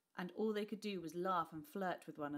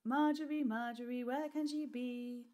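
playful calling; 6 semitones higher, 5 dB louder than usual. A narrator's reading. Playful call in hide and seek.

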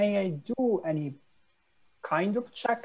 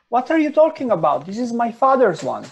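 indignant protest; 6 semitones higher, 10 dB louder than usual. Acted. Player protests in character.

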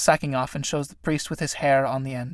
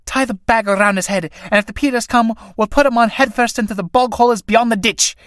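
urgent; 6 semitones higher, 5 dB louder than usual. Acted. Tinkers scramble to gag priest.

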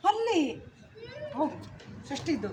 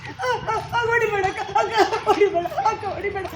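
raised cries under mock blows; acted pained pleading, high and insistent; demonstrated pleading; 3 semitones higher, 4 dB louder, slower than usual. Shown as a demonstration. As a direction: yelp and beg for the hitting to stop. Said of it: "ನಾನು ಇವಾಗ ಮಾಡಿ ತೋರಿಸಿದ್ನಲ್ಲ".

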